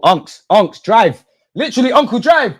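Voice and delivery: loud, high urging yell; urgent yell at the screen; shouting at the player. Real, not acted. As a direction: scream at him to run with it.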